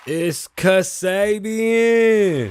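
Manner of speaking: long, high, loud drawn-out announcer yell; high sustained hype call; belting with a shot voice. Real, not acted. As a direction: stretch the name out, yelling high on a worn voice. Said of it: "My voice is still shot".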